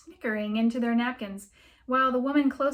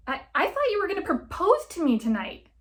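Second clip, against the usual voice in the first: shocked; 9 semitones higher, 7 dB louder than usual. Acted. Shocked girlfriend cries out.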